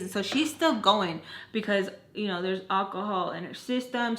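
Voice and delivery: normal talking voice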